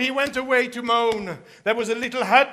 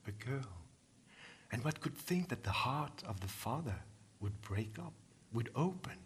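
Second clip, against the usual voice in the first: much softer, hushed storytelling voice; hushed narration; 14 semitones lower, 18 dB quieter than usual. A narrator's reading. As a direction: draw the listeners in with a near-whisper.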